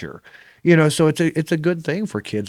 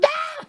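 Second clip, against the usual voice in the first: very high mock shriek with a laugh; a kid's scream imitated through laughter. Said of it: "He started screaming".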